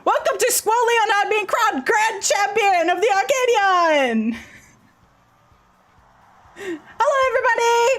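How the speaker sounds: high, loud arena host greeting; hype announcer. Acted. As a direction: whip up the crowd with excitement.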